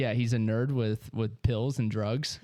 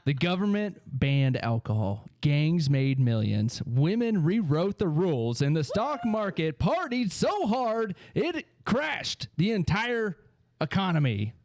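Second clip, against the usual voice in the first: dramatic narrator; 4 semitones higher than usual. Acted. Dramatic intro of the topic.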